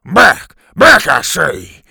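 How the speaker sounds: very loud, fuming order; loud, forceful warding-off yell; angry shouted command. A narrator's reading. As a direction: drive an animal back with a shout. Fuming teacher threatens dinosaur with stick.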